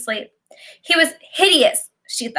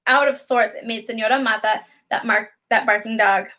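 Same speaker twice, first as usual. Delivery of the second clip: usual speaking voice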